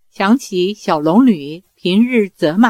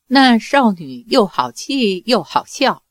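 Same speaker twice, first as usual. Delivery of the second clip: ordinary delivery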